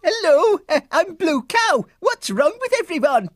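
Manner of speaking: high, sing-song cow voice, dreamy; a curious cow musing to herself; lilting high cow voice; Blue Cow. Acted. Blue Cow greets Florence.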